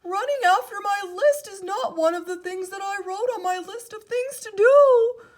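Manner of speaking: high, loud fussy Toad; upset Toad character voice. A narrator's reading. Toad's voice in story.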